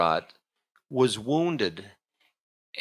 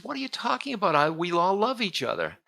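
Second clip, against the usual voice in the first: pitched well higher, disbelieving queen; Kakai's innocent protest; 10 semitones higher, faster than usual. Retold or imitated. Queen protesting to servant that all love each other, high disbelieving voice. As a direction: squeak out the protest like a puzzled queen. Kakai rejects the poisonous idea.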